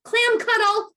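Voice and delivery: high-pitched loud call-out; playful yell. Shown as a demonstration. Caregiver calling children to hug themselves, yelling. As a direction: yell the silly command at the kids. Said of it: "And then you can yell".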